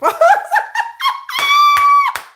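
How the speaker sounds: high, loud shriek of laughter. Real, not acted. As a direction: explode into a squealing laugh. Bursts out laughing.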